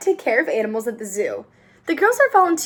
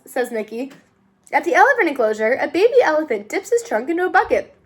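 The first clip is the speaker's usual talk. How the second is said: ordinary delivery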